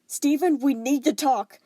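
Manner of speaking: loud, slow firm call through the door. Acted. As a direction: call him out firmly. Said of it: "She was firm".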